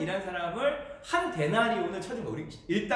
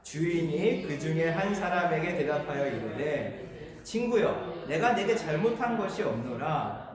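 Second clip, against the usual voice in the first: steady, lower-pitched Bible reading; measured read-aloud of scripture; 4 semitones lower than usual. Real, not acted. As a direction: read the verse out evenly with the congregation. Leads congregation reading scripture.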